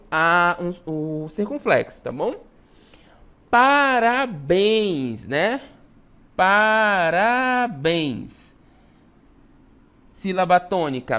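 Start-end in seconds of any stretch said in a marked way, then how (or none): 3.5-5.3 very slow "parabéns", stretched PA-RA-BÉNS; syllable separation. Shown as a demonstration. Teacher demonstrating the tonic syllable, drawn-out "parabéns". Teacher splits example word. 6.3-8.6 hugely stretched "parabéns" as PA-RA-BÉNS; syllable separation. Shown as a demonstration. Teacher repeating the example for pupils, drawn-out "parabéns".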